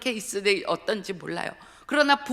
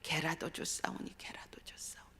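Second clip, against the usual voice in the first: whispered inner echo, fading away; echoing in the mind; 2 semitones lower, 12 dB quieter than usual. Retold or imitated. A preacher recalls the verse in her head, whispered. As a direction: let 개라도 줬사오니 fade to a whisper. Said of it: "제 머리에 맴맴맴 하는 건".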